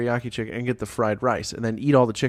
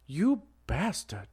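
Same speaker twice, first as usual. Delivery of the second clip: mock Chinese-accented curse, slow and drawn out; a bad put-on accent, drawn slow; terrible accent; 5 semitones higher, 6 dB quieter, slower than usual. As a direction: imitate the owner's kid badly, slow. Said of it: "this is gonna be a terrible accent".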